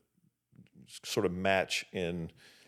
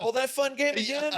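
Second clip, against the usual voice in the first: put-on much higher, louder sarcastic kid; mock kid voice; 4 dB louder than usual. Son mocking the chore game in a squeaky kid voice. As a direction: play a kid who sees through the game.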